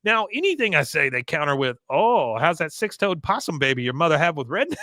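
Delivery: put-on high, loud accented rant; hammed-up accented whining rant; Con's rant. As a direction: complain bitterly about the office. Con voiced ranting.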